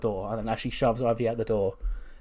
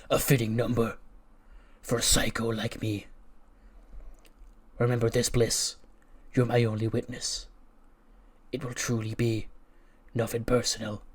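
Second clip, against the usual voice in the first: menacing villain. Acted. Karrion Kross gloats to Alexa.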